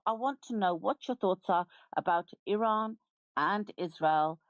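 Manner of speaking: usual speaking voice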